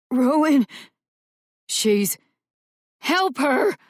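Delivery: high, loud desperate cries; screaming. Acted. Boy screaming for help for Rowan, desperate shouts. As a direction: cry out for help in panic. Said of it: "Gabriel screamed".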